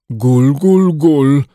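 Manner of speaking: bubbling gul gul gul; gargling. A narrator's reading. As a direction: gargle the words like water. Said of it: "wybełkotał Paddington".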